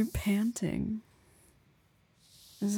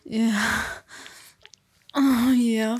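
loud moaning; 7 dB louder, slower than usual. Acted. Moaning in climax.

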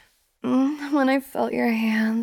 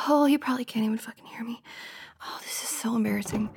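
quick, softer whispered mutter; fast whispered aside; 5 dB quieter, much faster than usual. Acted. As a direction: hiss it to yourself, embarrassed. Embarrassed aside to herself.

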